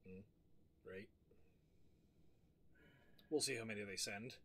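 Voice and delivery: usual speaking voice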